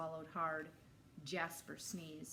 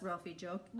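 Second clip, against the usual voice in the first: ordinary delivery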